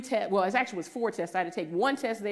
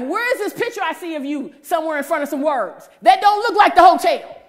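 husband questioning a photo she posted; suspicious husband; 8 semitones higher, 12 dB louder than usual. Retold or imitated. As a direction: call her out like a suspicious husband.

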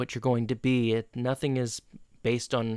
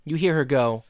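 usual speaking voice

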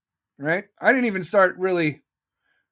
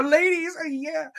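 flirty aside; 11 semitones higher than usual. Pretend. Bedroom joke, flirty aside.

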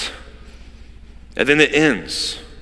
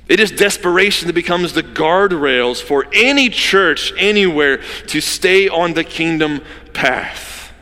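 ordinary delivery

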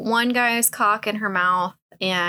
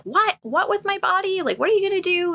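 much higher, startled character voice; mock alarm; 11 semitones higher, faster than usual. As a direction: play her bewildered protest in a high pitch. Character alarmed at men's threat.

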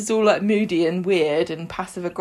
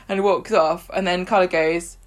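normal talking voice

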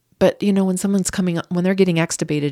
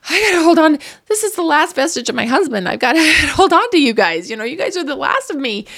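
high, loud, desperate clinging plea; desperate pleading; 10 semitones higher, 6 dB louder than usual. Retold or imitated. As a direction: beg the family not to let go. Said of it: "I'm grasping at them".

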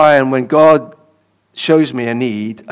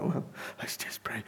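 whisper; 2 semitones lower, 20 dB quieter than usual. Retold or imitated. Unsure what to pray for.

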